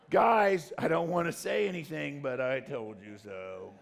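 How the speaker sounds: smug, drawn-out gloating, slow on the last line; mock smug gloating; smug I told you so. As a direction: gloat like a know-it-all friend. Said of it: "I told you so person".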